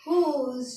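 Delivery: whose as French rose, starting on a throaty r; French pronunciation of whose. Shown as a demonstration. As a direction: open whose with a breathy French r, end on z. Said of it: "क्या पढ़ेंगे?".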